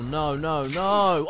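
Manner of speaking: ordinary talk, nothing marked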